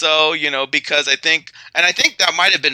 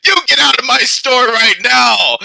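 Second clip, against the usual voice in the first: yelled; 7 semitones higher, 5 dB louder than usual. Retold or imitated. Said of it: "he yelled, he said".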